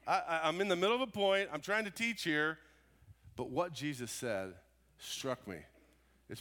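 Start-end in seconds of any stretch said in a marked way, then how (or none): 0.1-2.6 high, loud, annoyed protest at the interruption; exasperated outburst. Retold or imitated. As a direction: play yourself fed up, cut off mid-sentence. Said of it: "I would have been like".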